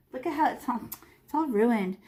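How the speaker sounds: normal talking voice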